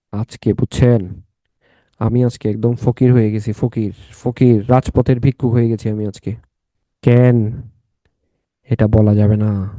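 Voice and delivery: low-pitched, drawn-out self-pity; mock lament. Real, not acted. Mock lament about being broke. (নিচু গলায় দুঃখের নাটক)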